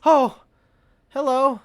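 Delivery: loud, high, chirpy hello; Hannah's greeting. Acted. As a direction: greet her brightly in character. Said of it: "she's like".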